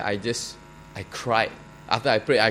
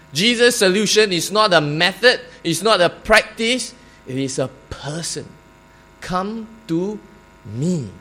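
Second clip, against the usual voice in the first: preaching; 6 semitones higher, 5 dB louder than usual. Acted. Preacher builds to emphatic point.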